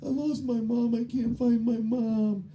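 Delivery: put-on high, childlike plea; lost little boy. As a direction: sound like a small worried kid. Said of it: "He goes".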